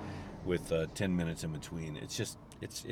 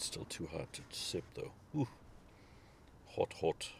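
breathy, half-whispered after a hot sip; sipping hot coffee; 11 dB quieter than usual. Real, not acted. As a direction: talk between careful sips of hot coffee. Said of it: "just sipping some".

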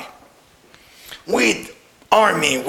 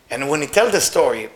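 ordinary delivery, nothing marked

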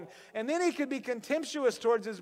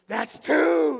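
louder, higher angry count; irritated farmer; 6 semitones higher, 10 dB louder than usual. Retold or imitated. Farmer warning his stubborn mule, angrily. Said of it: "He's like".

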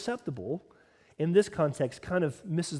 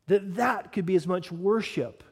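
ordinary talk, nothing marked

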